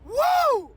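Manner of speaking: high, loud joyful whoop; ecstatic whoop. Real, not acted. Morning preacher whooping for his viewers, ecstatic whoop. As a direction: let out a wild happy whoop. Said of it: "Man, I'm excited".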